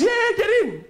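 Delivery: high casual driver. Retold or imitated. The driver telling his passengers to get in, high and breezy. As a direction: wave them into the car, high and breezy.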